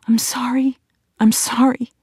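high, whispery apology; faint, distraught apology. A narrator's reading. Erin still apologising after her outburst, faint and distraught. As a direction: repeat it high and breathy, as if fading away. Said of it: "getting fainter and fainter".

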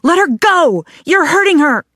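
high, loud cry of alarm; screaming protest. A narrator's reading. Alice shoving between two women to break it up, screaming. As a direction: yell it high and urgent to make them stop. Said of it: "also screaming".

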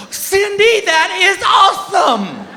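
high, slow, gushing shout; mock gushing husband. Husband mocking wives' game night, loud gushing. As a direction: ham up fake delight. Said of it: "They go".

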